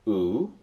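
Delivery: "oo" slow and long, like moon. Shown as a demonstration. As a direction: stretch out "oo" as in moon. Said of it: "as in moon".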